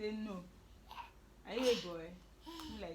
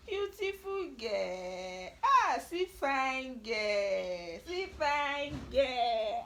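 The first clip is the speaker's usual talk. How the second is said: slow, drawn-out, louder adoring praise; 5 dB louder, much slower than usual. Real, not acted. Mother slowly admiring her beautiful daughter, drawn out. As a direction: savour every word in admiration.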